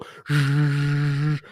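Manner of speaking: long hissing furnace noise; imitated furnace whoosh. Showing how the furnace blasts through the holes, whooshing. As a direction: make the rush of air from a duct. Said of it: "it wouldn't go".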